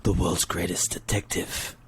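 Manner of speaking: mock-grave low hero musing; Bruce Wayne. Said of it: "And then Bruce is like".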